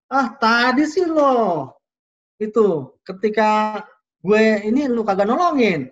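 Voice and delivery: loud, drawn-out acted outburst; high emotion. Participant blaming a teammate for not helping, heated mock outburst. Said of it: "emosinya tuh tinggi".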